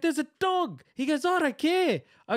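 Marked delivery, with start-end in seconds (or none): 0.0-0.8 high-pitched protest, acted out; retold frantic warning. Retelling frightened reply to truck owner. 1.3-2.0 high-pitched dismissive retort, imitated; angry old bloke. Acting the truck owner's retort.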